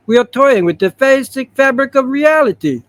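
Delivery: put-on high, forceful Russian-accented hero voice; Colossus. Comic hero warning about reality, hammed-up Russian voice. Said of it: "I'm gonna do the Colossus from".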